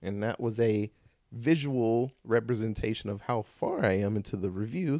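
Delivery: ordinary talk, nothing marked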